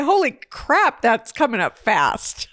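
high, startled blurt; surprised exclaim. Retold or imitated. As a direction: gasp it out in surprise. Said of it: "I was gonna say".

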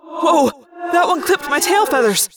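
high, agitated calls mid-battle; shouting back. A narrator's reading. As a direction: call out while dodging fire. Shouting back while under fire.

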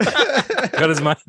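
slow, drawn-out mock order; mock command. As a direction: stretch it out, playfully bossy. Repeated mock demand, slower.

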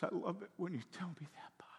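put-on fast soft whisper of a little girl. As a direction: breathe it like a child's secret. Granddaughter's tender words retold.

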